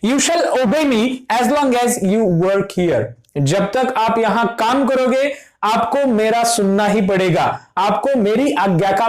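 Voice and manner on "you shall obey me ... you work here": very slow, word by word, like dictating; slow dictation. Shown as a demonstration. Teacher reading an example sentence to the class, very slow. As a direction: spell the example out slowly for students. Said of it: "या फिर".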